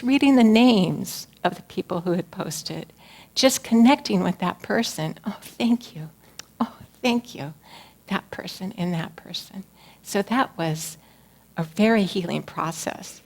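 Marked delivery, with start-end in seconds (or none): 5.2-9.6 soft breathy whisper of thanks; hushed grateful murmur. Retold or imitated. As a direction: whisper each thank you gently. Retelling her whispered thanks.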